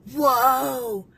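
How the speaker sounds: loud, drawn-out mock-impressed whoa; amazed retold whoa. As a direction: imitate the girl's big wowed outburst. Retelling girl's amazed reaction to glasses.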